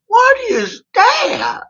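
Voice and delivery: high, slow, stretched-out disbelief; drawn-out high wail of confusion; baffled cry. Retold or imitated. Preacher staring at the strange food, a high, slow baffled cry.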